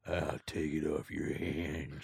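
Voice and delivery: low, slow, creepy mumble; slow, soft, low character voice; put-on buyer voice. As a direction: imitate a shady buyer, low and drawn out. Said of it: "In that voice, by the way".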